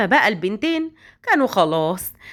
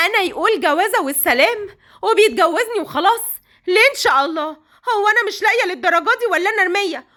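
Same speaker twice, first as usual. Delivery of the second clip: indignant tearful outburst; 6 semitones higher than usual. Acted. Basma alone after hearing him, tearful and furious. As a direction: rage through hurt pride.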